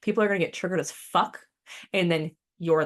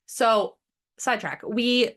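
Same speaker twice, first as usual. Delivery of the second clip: ordinary delivery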